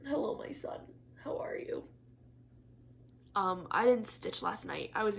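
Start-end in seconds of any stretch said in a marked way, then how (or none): 0.0-0.8 higher, breathy, near-voiceless coo. Real, not acted. Greeting her cat Ollie. 1.2-1.8 whispered pet talk. Real, not acted. Cooing to the cat.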